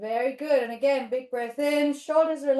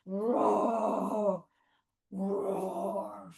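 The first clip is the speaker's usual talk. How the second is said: two long, drawn-out roars; low rumbling play roar; big bear roar; 3 semitones lower than usual. Pretend. Teacher stomps as a bear, long roars. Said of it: "Big bear".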